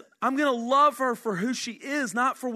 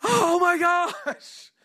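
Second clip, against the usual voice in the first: high, slow exclaim, flung out like news from a rooftop; rooftop shout; 9 semitones higher, 4 dB louder, much slower than usual. Shown as a demonstration. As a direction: proclaim it up and out. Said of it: "it's like shouting it from the rooftops".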